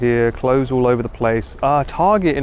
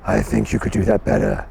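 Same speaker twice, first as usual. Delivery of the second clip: somewhat deep, throaty character voice; pressed low voice; 5 semitones lower than usual. Shown as a demonstration. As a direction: push the voice down into the throat.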